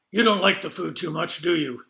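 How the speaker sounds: put-on gruff, slurred old woman teasing. Judy teasing again, same voice.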